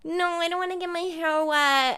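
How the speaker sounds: high, whiny mock of a friend; whiny friend quote. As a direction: mimic a fussy girl refusing the water. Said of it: "they were both like".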